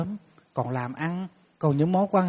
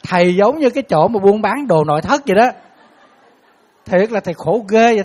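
exasperated complaint; 5 semitones higher, 9 dB louder than usual. Real, not acted. Monk complains comically.

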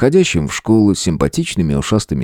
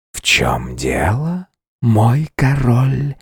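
slow hissing female voice in the head; whispery telepathic voice; 4 semitones higher, much slower than usual. A narrator's reading. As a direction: breathe it slowly like a seductive spider. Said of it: "Женский шипящий голос раздался прямо в голове". (шипящий женский голос в голове)

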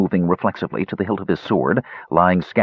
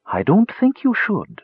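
coaxing cat; 12 semitones higher than usual. A narrator's reading. Cat warns squirrel.

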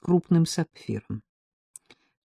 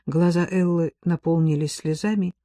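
ordinary delivery, nothing marked

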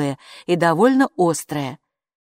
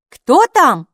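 loud, high call toward the door; shouted; 8 semitones higher, 10 dB louder than usual. A narrator's reading. Hilla calling to the door from the kitchen, shouting. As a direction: call out loudly to whoever is at the door. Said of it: "крикнула Хилла".

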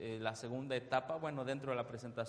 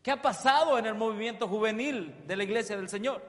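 full-force preaching; 8 semitones higher, 10 dB louder than usual. Acted. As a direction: preach it out to the congregation, stretching each word. Preacher proclaiming again. (predicación a toda voz)

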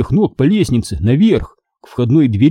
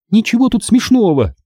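put-on high-pitched miffed girl; offended huff; 10 semitones higher than usual. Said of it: "фыркнула она обиженно". (обиженное фырканье)

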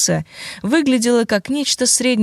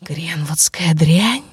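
hissed curse; 2 semitones lower, much slower than usual. Acted. Undead creature curses Mark.

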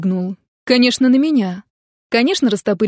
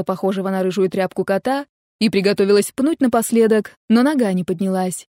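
ordinary delivery, nothing marked

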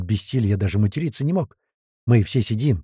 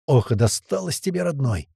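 ordinary delivery

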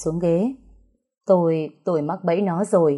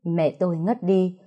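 ordinary delivery

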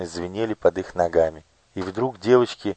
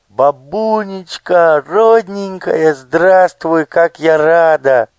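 much higher, louder, slow gushing joy; overjoyed; 10 semitones higher, 9 dB louder, slower than usual. Acted. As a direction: gush with delight over granny. Olya hugs grandmother joyfully.

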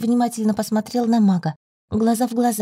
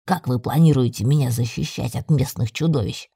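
low male familiar voice; deep-voiced magical companion; familiar speaking up; 8 semitones lower than usual. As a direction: challenge the team in a deep voice.

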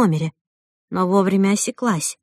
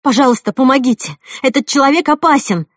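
loud, high cry for help; alarmed, raised appeal; frightened plea; 5 semitones higher, 6 dB louder than usual. Acted. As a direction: beg bystanders urgently for help.

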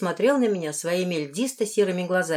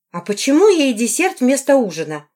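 higher, louder reproach; accusing question; 5 semitones higher, 6 dB louder than usual. A narrator's reading. A woman questions the lord about the girl's dessert, accusing. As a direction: demand an explanation, accusingly. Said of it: "с обвинительными нотками в голосе".

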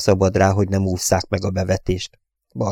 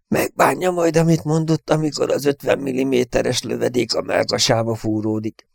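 higher, mournful inner grumble; gloomy thought; 5 semitones higher than usual. A narrator's reading. Airman imagining his own death by gunfire, gloomily. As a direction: think it mournfully, with self-pity. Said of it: "gondolta gyászosan".

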